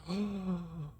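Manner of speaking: theatrical gasp; mock gasp. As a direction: gasp in fake shock. Mock surprise at reveal.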